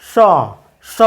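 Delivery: "স" as retroflex ṣ, tongue curled back. Shown as a demonstration. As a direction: curl the tongue to the palate roof for "স". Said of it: "এর উচ্চারণ হওয়া উচিত ছিল".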